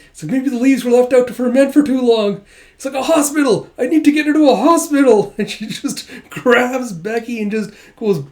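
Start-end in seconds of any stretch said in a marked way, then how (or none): 0.3-2.4 panicked fretting. Retold or imitated. Yor panicking. 2.9-5.3 much higher and louder, fast; frantic shout. Retold or imitated. Yor deciding to rush Becky to hospital, frantic shout.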